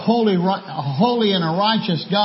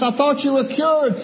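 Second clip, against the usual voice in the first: incredulous bartender; 7 semitones higher than usual. Acted. Bartender splashed again in joke.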